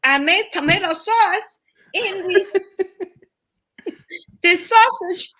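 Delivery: high-pitched imitation Vietnamese accent. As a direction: mimic a puzzled roommate with a Vietnamese accent. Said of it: "and she was Vietnamese".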